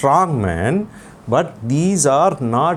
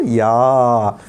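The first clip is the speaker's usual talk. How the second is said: ordinary talk, nothing marked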